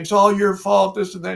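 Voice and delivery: high, loud imitated scream of a wife faking madness; mock scream. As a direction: shriek the blame like she's lost her mind. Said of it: "screaming at George Washington".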